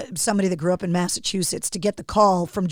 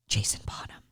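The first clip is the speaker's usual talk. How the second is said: soft whisper of the full name; whispered correction; 14 dB quieter than usual. Retold or imitated. Whispering the full name to an interviewee. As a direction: breathe the full name like a quick aside. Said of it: "I'm always very quick to be like".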